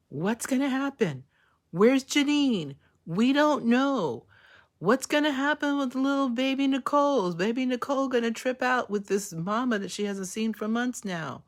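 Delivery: a fan wound up about the next episode; excited speculation. Real, not acted.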